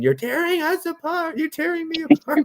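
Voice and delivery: very high theatrical wail; hammed-up melodrama. Quoting Tim's dramatic outburst about the films, squealing high wail. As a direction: ham it up like a soap actor. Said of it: "he's like".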